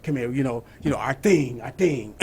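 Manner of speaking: low, soft Italian-American mobster mumble; mobster accent. Retold or imitated. Tony Soprano impression to the congregation, low mobster mumble. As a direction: mumble it like a New York mob boss. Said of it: "with that accent".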